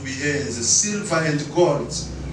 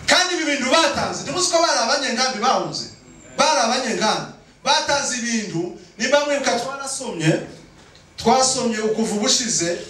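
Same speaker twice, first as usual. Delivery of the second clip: raised-pitch sermon delivery; insistent preaching; 7 semitones higher than usual. Real, not acted. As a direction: press the point on the congregation. Preaches at full force.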